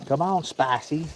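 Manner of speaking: slow, high sing-song call to the cat; high coaxing call. Real, not acted. Man calling his cat over to the chicken, high and slow. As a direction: coax her over, high and drawn out.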